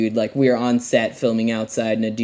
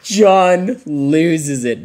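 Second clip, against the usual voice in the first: normal talking voice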